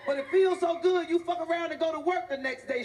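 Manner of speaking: usual speaking voice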